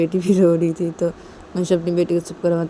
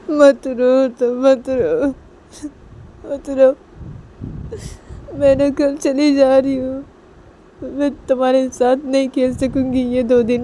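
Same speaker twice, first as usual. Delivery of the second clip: crying; 5 semitones higher than usual. Acted. Nisha comforts her baby before leaving, crying as she speaks. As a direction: wail the words as if sobbing. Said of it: "से रोते रोते बोलने लगती है".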